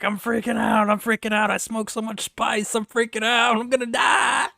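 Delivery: loud, high, frantic imitation of freaking out; mock panic. Panicking kid confesses to the patrol, mock-hysterical high shouting. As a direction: build to a hammed-up terrified yell. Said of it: "freaking the hell out".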